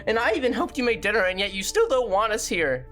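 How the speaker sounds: ordinary talk, nothing marked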